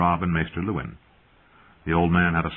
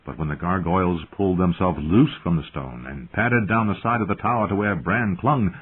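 plain reading voice

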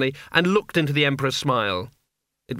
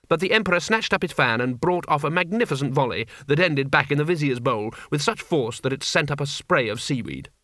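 ordinary delivery, nothing marked

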